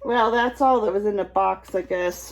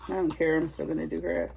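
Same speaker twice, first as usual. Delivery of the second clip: ordinary talk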